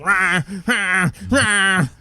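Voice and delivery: high, repeated mock cat yowl; put-on complaining cat cries; imitated pissed-off meow. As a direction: meow like an annoyed cat demanding food. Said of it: "he's pissed".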